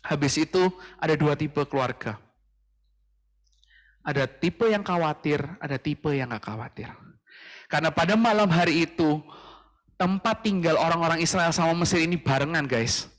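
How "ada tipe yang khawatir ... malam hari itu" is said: ordinary delivery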